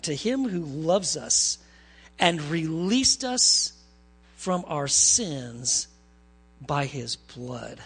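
very slow, weighty verse reading; scripture reading. Real, not acted. Preacher reading verse five as worship fuel, slow reverent reading. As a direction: draw out each phrase with pauses, reverently.

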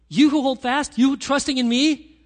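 rally them with urgent fervour; Jesus calling the faithful. Acted. Jesus urging believers to hold fast, loud impassioned call. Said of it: "What Jesus is saying".

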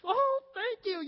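high, loud, drawn-out mock thanks; gushing relief. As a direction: imitate an overjoyed driver thanking a rescuer. Imitates relieved driver thanking him.

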